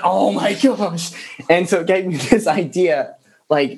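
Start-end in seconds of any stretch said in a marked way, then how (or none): 0.0-1.1 high, drawn-out mock exasperation; long-suffering, stretched-out complaint; exasperated. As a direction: groan it slowly as if driven mad. Retelling his exasperation at the toy.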